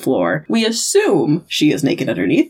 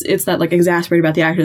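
ordinary talk, nothing marked

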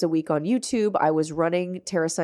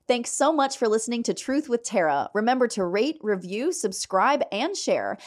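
bright sign-off read, slightly higher; podcast outro; 3 semitones higher than usual. Acted. Host signs off Truth with Tara and asks listeners to subscribe, upbeat outro read. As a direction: wrap up the show with a friendly call to action.

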